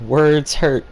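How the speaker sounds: loud mock-dramatic declaration; mock-dramatic shout. Streamer loudly jokes that words hurt him, mock-dramatic shout. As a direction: boom it out like a hurt hero. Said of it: "repeated loud declaration".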